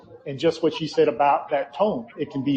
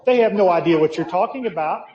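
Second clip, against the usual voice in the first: usual speaking voice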